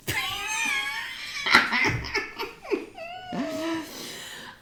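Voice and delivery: high, drawn-out helpless laugh; long squealing burst of laughter. Real, not acted. Speaker cracking up mid-story, long high laugh.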